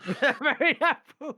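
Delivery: high, slow laughing echo. Real, not acted. Speaker tickled by the Apu slip, laughing. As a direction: repeat it helplessly through laughter. Echoing friend's remark while laughing.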